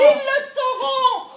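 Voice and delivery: very high, loud retort; insistent shout. Acted. Wife overruling Roger's doubts about the affair, shrieked insistence. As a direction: shout him down, voice leaping up. Insisting against his protest.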